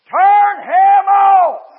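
mock holler, very loud and long-held; imitated hollering. Bertha interrupts the preaching, imitated long holler. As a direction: bellow each word slowly as the heckler. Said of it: "she was still a hollering".

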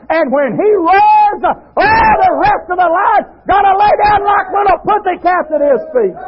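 fiery shouted sermon; preaching. Acted. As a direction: preach it out with full force. Preaches at full force.

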